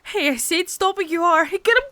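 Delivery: high, loud screaming command; full-voice frightened shout; panicked yell. Acted. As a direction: scream it across the dark. Shouting at attackers.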